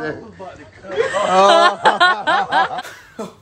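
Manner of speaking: loud, high real laughter; burst of laughter. Real, not acted. Vlogger cracking up at the video, loud laugh. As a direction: crack up at the clip.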